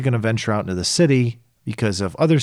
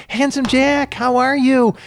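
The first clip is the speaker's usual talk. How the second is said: much higher, bright mock greeting to an old friend; cheery greeting; 14 semitones higher, 3 dB louder, slower than usual. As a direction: sing out his name like excited kids. Kids greeting Handsome Jack.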